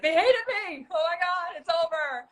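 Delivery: high-pitched mock panic; dramatic re-enacted despair, sliding down from very high; nervous wreck. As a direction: wail it like all is lost, hammed up. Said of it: "I was a nervous wreck".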